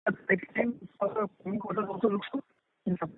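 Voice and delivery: muffled, as if through a hand. Real, not acted. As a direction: voice muffled and dull on the line. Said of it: "you're sounding muffled".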